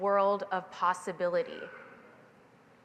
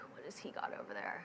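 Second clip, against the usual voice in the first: whispered, a bit faster, nosy; sneaky whisper; 5 semitones lower, 15 dB quieter, faster than usual. Retold or imitated. Girl eyeing her brother's pan, whispering curiously.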